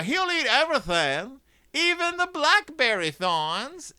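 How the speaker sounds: high put-on farm-wife voice, drawn out at the end; Missus Ben. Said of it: "says Missus Ben".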